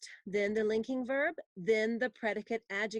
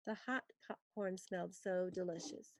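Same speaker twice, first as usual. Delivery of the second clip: much softer second reading; softer repeat; 2 semitones lower, 8 dB quieter than usual. Shown as a demonstration. Teacher quietly repeating a dictated sentence. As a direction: say it again quietly. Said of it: "And number four".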